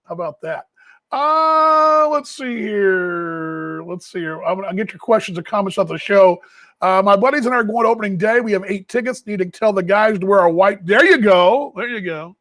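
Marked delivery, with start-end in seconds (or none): 1.1-3.8 let's see here stretched very slow; musing aloud while scanning comments; drawn-out stall. Real, not acted. Host stalls, reading listener comments, drawn-out very slow. 10.8-11.7 There you go, louder with a rising lift on you; approving rise. Real, not acted. Host approves a listener's plan, louder with a rise.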